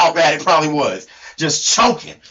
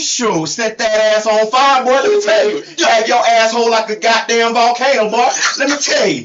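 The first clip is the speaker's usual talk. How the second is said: animated put-on country accent; hammed-up country-accent storytelling; Hee Haw Tony; 2 semitones higher than usual. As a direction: crow it in a mock hillbilly accent. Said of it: "has to sound like me".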